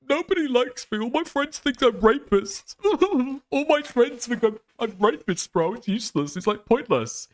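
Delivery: mocking a streamer moping about his friends; mock whiny Mizkif. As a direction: whimper it like a sulking kid. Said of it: "He was watching Mizkif, like, go".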